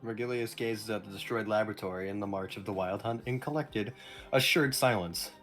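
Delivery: low, soft narrator read; story narration. A narrator's reading. Reads the game narration.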